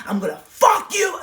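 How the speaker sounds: sudden high furious shout; snarling shout. Retold or imitated. To her ex in a jealous fight, furious shout. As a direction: bark it at the ex, full rage. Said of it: "look how I'm literally snarling".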